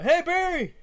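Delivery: high, loud shout across a distance; yelling. Acted. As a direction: call out to a friend far ahead. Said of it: "yell to Barry".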